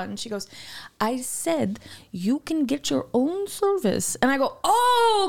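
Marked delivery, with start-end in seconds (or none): 1.0-4.2 slow, deliberate operator voice; imitated slow repetition. Imitates operator repeating herself slower. 4.6-5.3 loud, high drawn-out mock realization; retold outrage. Retold outraged reaction to operator.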